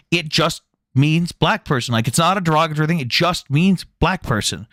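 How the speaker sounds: ordinary talk, nothing marked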